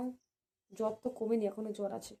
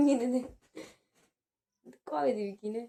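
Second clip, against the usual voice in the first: normal talking voice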